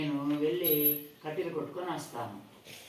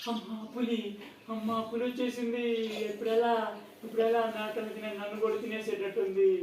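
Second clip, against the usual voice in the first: put-on terrified boy; 9 semitones higher, 3 dB louder than usual. Boy sees real tiger.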